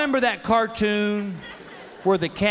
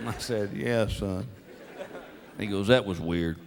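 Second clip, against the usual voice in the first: hushed father-son talk; 12 semitones lower, 7 dB quieter than usual. Retold or imitated. Quiet talk driving home.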